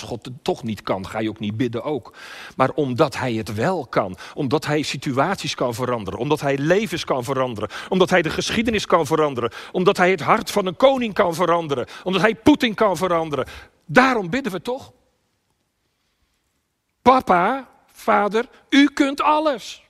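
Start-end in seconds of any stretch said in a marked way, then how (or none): 2.6-14.9 each clause climbs higher and louder; building preaching. Real, not acted. Preacher tells congregation God can change things, building. 17.0-19.8 high, loud cry, last words drawn out. Acted. Preacher as Jesus calling Papa in anguish, crying out. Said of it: "Hij schreeuwt het uit".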